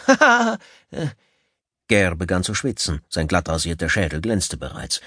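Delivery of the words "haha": loud, high startled laugh; laugh, caught off guard. Acted. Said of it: "lachte überrumpelt".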